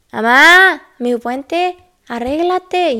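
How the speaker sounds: loud, high urgent call to mom. Retold or imitated. Waking her mother at night, loud urgent call. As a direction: yell it to wake her up. Urging mom to get ready.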